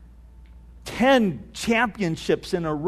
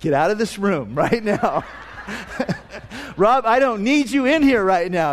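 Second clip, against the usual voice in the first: laughing retold scolding. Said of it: "Denise looks at me and says".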